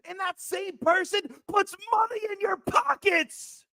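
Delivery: real pained yell; shouted. Real, not acted. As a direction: let the voice crack with anger. First take, peak of rant.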